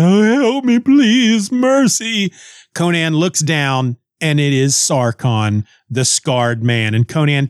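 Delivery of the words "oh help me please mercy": high, hammed-up cry for mercy; imitated wailing victim; mock yelling for help. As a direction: beg for rescue in falsetto. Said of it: "who's, who's yelling".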